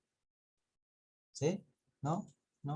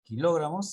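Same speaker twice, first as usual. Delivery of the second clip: "kilogramos" with a higher, louder LO; stress on LO; 5 semitones higher, 7 dB louder than usual. Shown as a demonstration. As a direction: punch the second syllable, lo, in "kilogramos". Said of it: "tendría que llevar tilde porque sería una esdrújula".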